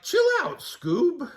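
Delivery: high, loud goofy Shaggy; cool and laid-back teen. Acted. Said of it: "said Shaggy".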